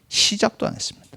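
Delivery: a whispered aside to the congregation; hushed whisper. Shown as a demonstration. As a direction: drop to a whisper on 시작도 안 했습니다. Repeating the phrase for emphasis.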